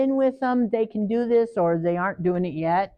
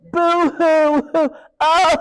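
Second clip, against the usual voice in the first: mock boo-hoo; 6 semitones higher, 7 dB louder, much slower than usual. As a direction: fake high, loud boo-hoo into laughter. Mocking a manipulative child crying.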